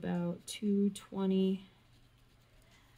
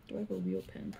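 "Do I have a real pen?" said very low and soft; low mumbled repeat; 12 semitones lower, 6 dB quieter, faster than usual. Real, not acted. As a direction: mutter "Do I have a real pen?" deep under your breath.

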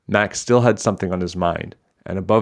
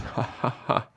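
soft, airy string of ha-has; trailing amused laugh; acted laughter; 4 semitones higher, 8 dB quieter than usual. A narrator's reading. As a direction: laugh on and on, breathily. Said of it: "With Mac's laughter ringing in their ears".

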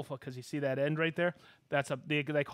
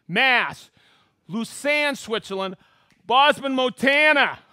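loud, high announcer shout-outs; excited host roll call; 7 semitones higher, 12 dB louder, slower than usual. Acted. Host hailing viewers watching from around the world, excited roll call. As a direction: call out each place like a rowdy emcee.

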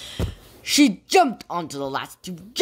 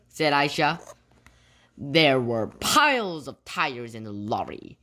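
ordinary delivery, nothing marked

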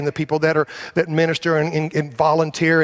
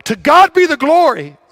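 "To God be the glory" called out much higher and louder; emphatic declaration; 11 semitones higher, 9 dB louder than usual. Shown as a demonstration. Pastor showing the congregation the emphatic cue, called out high and loud. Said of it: "when I say it emphatically".

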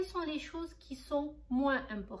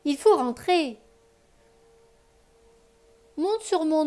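put-on lively read-aloud voice for children; expressive bedtime story reading; story narration; 13 dB louder than usual. A narrator's reading. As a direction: tell the tale warmly to a child listener.

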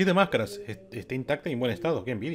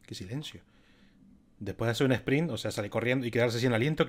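ordinary delivery

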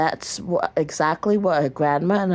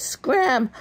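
high mock holler at the birds; 6 semitones higher than usual. A narrator's reading. Grandma yelling at birds on the pie, raised holler. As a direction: chase them off, half-shouting. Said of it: "hollered Grandma".

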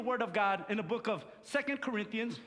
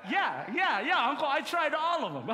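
eager; 5 semitones higher, 5 dB louder than usual. Retold or imitated. Boy answering uncle eagerly.

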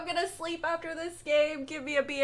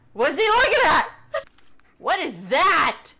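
louder, higher frightened questions; rising panic; 5 semitones higher, 8 dB louder than usual. Real, not acted. Gamer hearing strange things in the mine, panicked. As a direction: demand answers from the dark, spooked.

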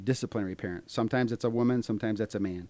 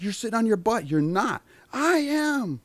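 high-pitched mock parent-child squabble; acted bickering; 12 semitones higher, 4 dB louder than usual. Acted quarrel over getting ready.

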